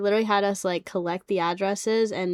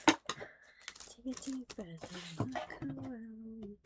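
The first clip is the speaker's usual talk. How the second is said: soft, slow ASMR voice; 3 semitones higher, 12 dB quieter, slower than usual. Acted. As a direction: ease them into a calm breath, slowly. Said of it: "like, A S M R voice".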